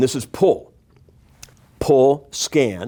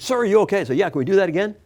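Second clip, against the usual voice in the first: eager, higher voice; 8 semitones higher, much faster than usual. Retold or imitated.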